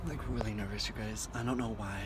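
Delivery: hushed, jittery admission; real nerves, kept low; nervous. Real, not acted. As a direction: confide your nerves softly. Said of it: "I'm so nervous".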